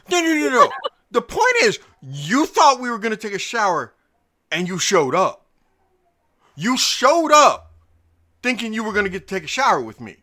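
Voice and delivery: high, loud mocking [name]; [name] impression. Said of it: "you know how when [name] talks".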